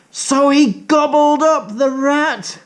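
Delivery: high excited telling; shocked storyteller; high gasping narration; dramatic gasp. A narrator's reading. Gulping up the rat.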